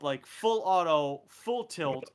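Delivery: ordinary delivery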